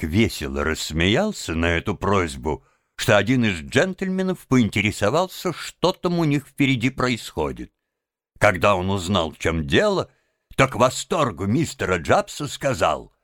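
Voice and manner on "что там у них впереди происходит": usual speaking voice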